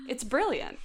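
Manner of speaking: usual speaking voice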